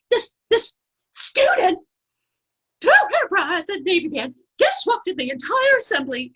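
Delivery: a rattled teacher rushing to report; nervous teacher. Retold or imitated. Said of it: "like, nervous".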